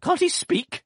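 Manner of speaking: loud, high exasperated outburst; impatient cry. A narrator's reading. Aunt crying out impatiently at the bowing doctor. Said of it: "cried my aunt impatiently".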